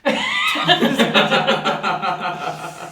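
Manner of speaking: long, loud peal of laughter; loud laughing. Real, not acted. Player laughing at a teammate's risky move, loud laughter. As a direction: burst out laughing and keep going.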